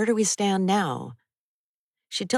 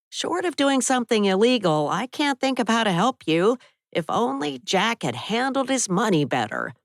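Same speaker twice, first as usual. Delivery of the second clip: lightly voiced violin teacher; 7 semitones higher, 4 dB louder than usual.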